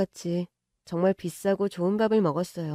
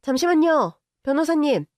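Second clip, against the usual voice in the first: higher and louder call; 6 semitones higher, 4 dB louder than usual. Acted. Girlfriend calling the lawyer in from outside, loud. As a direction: call through the door. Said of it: "문 밖을 향해 외쳤고".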